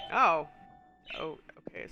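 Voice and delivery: usual speaking voice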